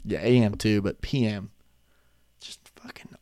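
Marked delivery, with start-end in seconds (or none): 2.4-3.2 whispered self-mocking aside. Real, not acted. Whispering to viewers about feeling old. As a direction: breathe it out as a whisper.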